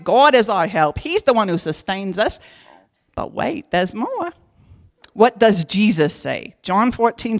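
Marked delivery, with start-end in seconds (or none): 3.1-4.3 higher, softer salesman tease; mock infomercial pitch. To congregation, lightly voiced infomercial tease.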